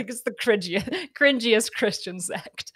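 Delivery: laughing while poking fun, breaking up mid-word; can barely get the jab out for laughing; amused laughing. Real, not acted. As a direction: giggle through the words. Laughing while mocking a sect.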